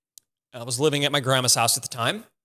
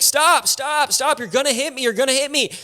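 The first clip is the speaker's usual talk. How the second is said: high, loud alarmed shouting at a reversing driver; sharp high panicked cry to stop; panicked yell; 10 semitones higher, 3 dB louder than usual. Retold or imitated. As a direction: yell a warning before the crash. Yelling at car backing into him.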